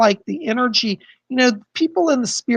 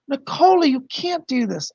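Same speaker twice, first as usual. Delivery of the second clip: touched disbelief; 5 semitones higher than usual. Real, not acted. Protesting the generous donation.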